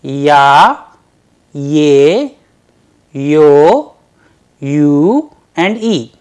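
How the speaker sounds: loud, clearly separated Ya, ye, yo, you; Russian soft vowels. Shown as a demonstration. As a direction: spell out each soft vowel loudly. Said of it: "Let's repeat them once again".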